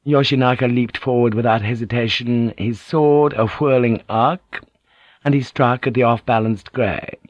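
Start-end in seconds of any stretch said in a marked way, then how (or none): none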